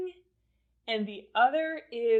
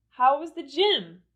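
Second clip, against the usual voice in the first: higher, louder question falling on "gym"; falling intonation; 4 semitones higher, 6 dB louder than usual. Shown as a demonstration. Teacher repeating the second question for learners, falling intonation on "gym". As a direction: bring "gym" down at the end. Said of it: "the intonation went down".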